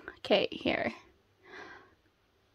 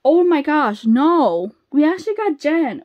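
loud, higher-pitched shock; thrilled surprise; 5 semitones higher, 6 dB louder than usual. Real, not acted. Fan pulls an unexpected member, loud thrilled surprise. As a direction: burst out in happy disbelief.

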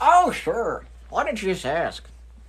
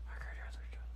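"I can't hear myself" in a bare soft whisper; 17 dB quieter than usual. Real, not acted. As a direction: breathe "hear" without voice.

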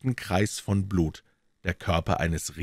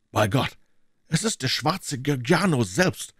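shout; 7 semitones higher than usual. A narrator's reading. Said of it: "schrie der Amerikaner".

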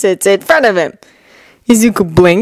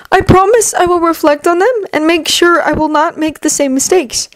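higher, earnest apology; sincere teen voice; 8 semitones higher than usual. Acted. Izuku formal apology.

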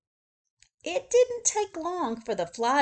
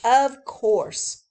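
louder eager realization; eager cry; 3 semitones higher, 8 dB louder than usual. Acted. Zookeeper realizing the truth, louder. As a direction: cry it out as it dawns. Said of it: "cried the zookeeper".

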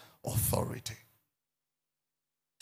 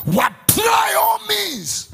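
full-voiced shout, much higher and louder; preaching shout; 11 dB louder, much slower than usual. Real, not acted. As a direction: bellow it out from the pulpit.

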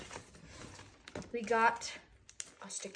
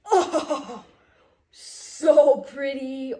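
delighted gush; 2 semitones higher, 11 dB louder, much slower than usual. Real, not acted. Kid delighted by new clothes.

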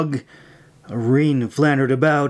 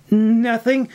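much higher, quick denial; said quickly; 10 semitones higher than usual. A narrator's reading. May hides the book's contents, quick and high. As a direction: blurt it out to dodge. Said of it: "May said quickly".

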